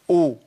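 أو begun on a glottal stop with an "u" vowel; hamza with damma. Shown as a demonstration. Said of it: "المضمومة؟".

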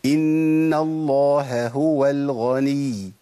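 إن الله هو الغني with the ه of الله and هو both pronounced, slow; slow recitation, each ه sounded separately; both h sounds clear. Shown as a demonstration. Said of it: "طب الصح إيه؟". (نطق الهاءين بوضوح في الله هو)

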